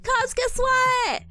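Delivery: high, loud, slow "guess what" call; excited announcement. Pretend. Announcing a screenshot to Cubs, excited and loud. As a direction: tease the big reveal. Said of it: "I'll be like".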